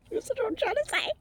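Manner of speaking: very high, squeaky voice; laughing squeal. Real, not acted. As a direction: squeal it right after a laugh. Squeals amid laughter over cards.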